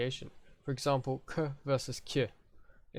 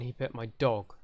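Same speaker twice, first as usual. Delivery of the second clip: "dog" punched at the end; stress on dog. Shown as a demonstration. Teacher contrasts dog with fish, stress on "dog". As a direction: land hard on "dog" as the contrast. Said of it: "He didn't pet my fish".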